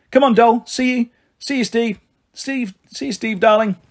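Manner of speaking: bright lilting farewell, high; sing-song goodbye. A narrator's reading. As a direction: call it out playfully, pitch up. Marigold sings out goodbyes.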